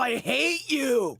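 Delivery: mock furious, drawn-out high shout; openly aggressive. Shown as a demonstration. Showing a friend how to be aggressive, hammed-up shout. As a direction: yell it slowly with exaggerated venom. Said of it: "be aggressive aggressive".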